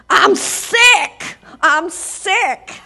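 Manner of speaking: high, loud holler of a sick man; imitated hollering. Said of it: "standing there hollering at his girlfriend".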